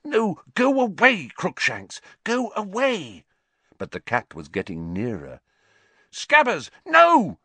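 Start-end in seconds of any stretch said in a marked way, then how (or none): 0.0-3.2 urgent shooing. Acted. Hermione shooing the cat. 6.1-7.4 panicked cry. Acted. Rat slipping from Ron's grip.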